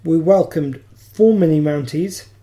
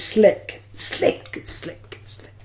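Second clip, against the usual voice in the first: mock-slick whisper; 8 dB quieter, much slower than usual. Co-host sending up the podcast's unprofessionalism, fading whisper. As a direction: fade into a voiceless whisper. Ironic boast of being professional.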